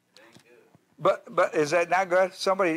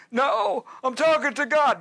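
higher, mock-indignant reply; a petulant answer, voice pitched up; defensive Elijah; 8 semitones higher than usual. Acted dialogue with Elijah.

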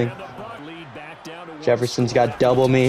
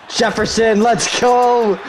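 raised, drawn-out celebratory call; excited cheer; 8 semitones higher, slower than usual. Real, not acted. Gamer cheering Jefferson's catch, excited. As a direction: cheer your receiver on.

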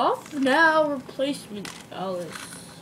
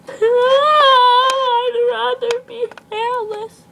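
crying; 9 semitones higher, 4 dB louder, much slower than usual. Acted. Acted lament, slow, cry.

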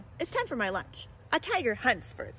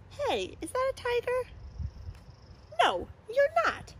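high squeaky animal voice; bright mocking doubter; lightly voiced teasing animal; high-voiced critic. High animal voices in picture book.

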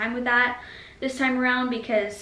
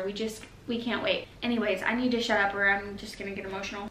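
normal talking voice

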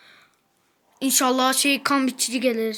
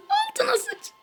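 taunting squeal. Real, not acted. Teasing the rival player in a squeaky high taunt. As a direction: jump way higher into a squeaky taunt.

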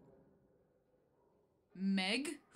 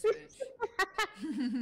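laughing; 6 semitones higher, 3 dB louder than usual. Real, not acted. Caught friend as demon.

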